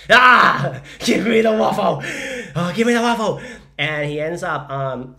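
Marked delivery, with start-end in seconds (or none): 0.1-3.4 high, very loud desperate cry; hammed-up frantic plea; desperate begging. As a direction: wail for it as if starving. Acted desperation for son's waffle.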